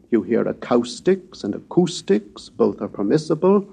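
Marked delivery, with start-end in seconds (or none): 0.5-2.5 "acoustics" first with a COW vowel, then with a COO vowel; two variant pronunciations. Shown as a demonstration. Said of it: "such variations exist in standard modern English".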